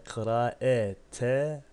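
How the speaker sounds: Kıraetehu stretched very slowly, syllable by syllable; Kıraetehu intoned very slowly, like a Quran teacher; slow Arabic recitation. Shown as a demonstration. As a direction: recite Kıraetehu slowly, in a voice set apart from the explanation. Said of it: "Neyi incele, irdele?".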